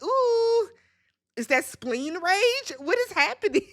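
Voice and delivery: mock alarmed. Mocking his spleen rage.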